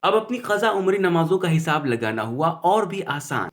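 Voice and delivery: ordinary talk, nothing marked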